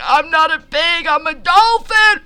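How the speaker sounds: very high squeaking voice rising to a dolphin shriek; dolphin impression. Narrator insisting to the farmer he is a dolphin, squeaky shriek. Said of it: "that was my dolphin impression".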